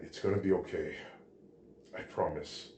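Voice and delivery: soft, comforting promise; hushed and tender, sinking away; gentle reassurance. A narrator's reading. Hero reassures his worried friend.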